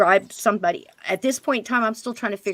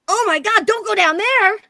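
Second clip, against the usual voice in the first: high, loud mock alarm; alarmed outcry; 13 semitones higher, 7 dB louder than usual. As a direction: yelp it as if truly startled. Retelling alarm at medium's words.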